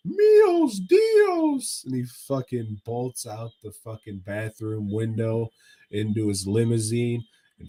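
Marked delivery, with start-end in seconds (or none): 0.1-1.8 very high, loud hammed-up Spanish exclamation; mock Spanish panic. A rich man fleeing the pimp, shrieking in a mock Spanish accent. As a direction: yelp it in a fake Spanish accent. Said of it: "And the guy's like".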